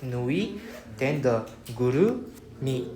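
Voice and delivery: nui, then guru, then mi; in parts. Shown as a demonstration. Teacher splits the word for students. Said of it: "pronounce the nui first".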